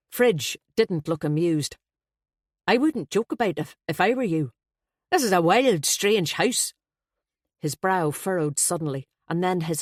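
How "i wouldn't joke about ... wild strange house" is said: a flustered, jovial Dubliner blurting out; Irish best man. Acted.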